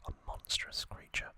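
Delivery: usual speaking voice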